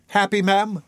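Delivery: put-on high, eager boy's question; delighted cry. A narrator's reading. Oliver answers his kind benefactress, crying out in delight. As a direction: pipe up in happy disbelief. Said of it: "cried Oliver".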